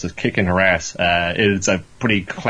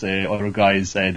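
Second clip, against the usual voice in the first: ordinary talk, nothing marked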